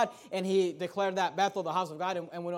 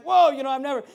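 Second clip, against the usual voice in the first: startled whoa; 5 semitones higher, 10 dB louder, faster than usual. Retold or imitated. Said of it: "freaking out about it".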